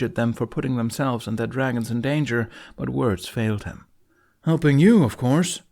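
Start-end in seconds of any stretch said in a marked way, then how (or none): 4.4-5.6 loud, cocky boast. Acted. Snotlout bragging to Hiccup, cocky boast. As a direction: say it like you're the hero. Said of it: "Snotlout boasted".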